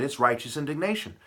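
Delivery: normal talking voice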